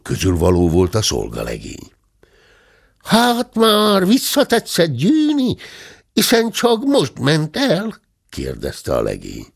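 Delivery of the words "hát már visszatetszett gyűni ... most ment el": put-on high, slow Slovak-accented Hungarian. A narrator's reading. Slovak servant asking the guest, in a Slovak accent. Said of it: "s tótosan beszélt".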